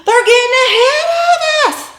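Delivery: high, loud frantic shout; husband racing to the finish, shouting; urgent yell. Retold or imitated. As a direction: yell it while scooping her up. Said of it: "He says".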